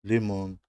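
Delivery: لیمون as a careful teacher's model; Russian word. Shown as a demonstration. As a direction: model لیمون for the learner, stressed on the last syllable. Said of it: "مثل لیمون".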